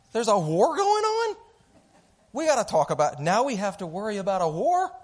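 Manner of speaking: shrieking mother-in-law. Retold or imitated. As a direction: squeal it in disbelief over the war. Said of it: "I am talking about my mom-in-law".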